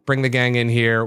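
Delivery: ordinary delivery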